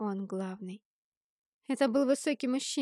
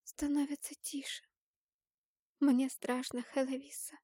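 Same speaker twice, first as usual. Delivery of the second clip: small, hushed, higher voice of a scared sister; holding back tears; 5 semitones higher, 3 dB quieter than usual. A narrator's reading. Frightened sister confiding in Helovisa, hushed and near tears. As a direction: speak softly, barely keeping the tears in. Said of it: "едва сдерживая слёзы, проговорила сестра".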